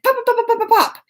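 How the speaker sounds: rapid-fire high pops; high string of spark noises; imitated popping. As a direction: pop it out fast like flying sparks.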